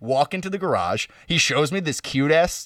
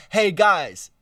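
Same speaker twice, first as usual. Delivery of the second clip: sarcastic; 6 semitones higher, 3 dB louder than usual. Retold or imitated. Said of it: "like, sarcastic voice".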